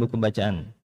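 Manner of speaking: ordinary talk, nothing marked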